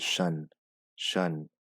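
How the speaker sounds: "shun" said crisply as the tion ending; shun sound. Shown as a demonstration. Teacher explaining the tion ending: "shun" sound. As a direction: give "shun" one clean sound. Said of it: "T I O N, whenever you see that".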